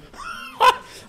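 lautes, hohes Auflachen; lautes Lachen. Real, not acted. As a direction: lach laut über den eigenen Patzer. Lacht über eigenen Fehler. (lautes hohes Auflachen)

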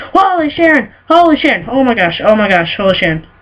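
high, loud shocked cry; shocked exclamation. Real, not acted. Gamer yelling after losing his favorite hat. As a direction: cry out at the loss.

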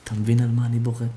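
normal talking voice

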